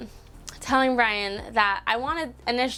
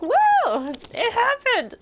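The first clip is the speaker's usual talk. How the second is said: very high, louder whoop; celebratory squeal; 13 semitones higher, 3 dB louder, much slower than usual. Retold or imitated. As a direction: squeal it out in triumph. Repeats the cheer after engagement.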